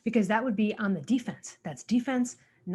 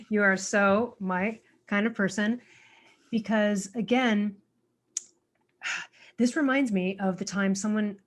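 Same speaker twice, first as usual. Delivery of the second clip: normal talking voice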